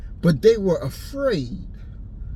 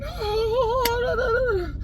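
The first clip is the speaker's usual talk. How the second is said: laughing chatter; 14 semitones higher, slower than usual. Retold or imitated. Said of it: "They laughing".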